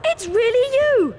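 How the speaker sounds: high, eager gush of a starstruck fan; meeting your hero at last, can't contain it; boy gushing over Harry, high and excited; starstruck excitement. Acted. Colin meets his hero Harry.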